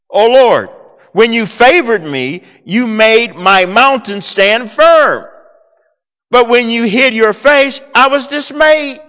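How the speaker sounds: scripture reading. Acted. Reading psalm aloud.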